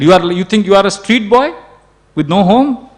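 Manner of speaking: mock reproach rising into an indignant question; scolding. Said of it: "she started scolding them".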